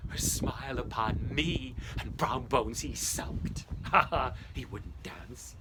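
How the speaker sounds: ordinary delivery, nothing marked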